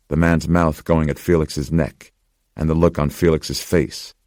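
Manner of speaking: usual speaking voice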